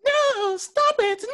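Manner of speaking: high-pitched hammed-up protest; mock panicked pleading. Friend joining in as the patient, high mock panic.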